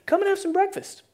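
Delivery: high, sing-out invitation; calling out. Acted. Preacher as Jesus inviting disciples to breakfast, high call. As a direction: call them over to eat, pitch way up. Jesus calls from beach, acted.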